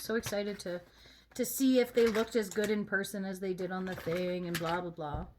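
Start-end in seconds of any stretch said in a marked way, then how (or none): none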